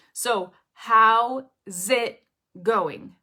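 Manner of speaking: "how's it going" slow and loud, split word by word; three separate words. Shown as a demonstration. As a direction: punch HOW, pause, then "it", then "going". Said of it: "imagine this being three words".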